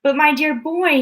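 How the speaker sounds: high, loud burst of delight; exploding with excitement. A narrator's reading. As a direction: blurt it out thrilled. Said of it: "exploded with excitement".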